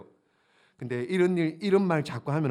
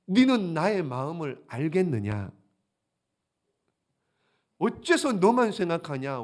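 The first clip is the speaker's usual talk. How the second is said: pleading God; 3 semitones higher, 6 dB louder than usual. Acted. God voiced pleading with Jonah.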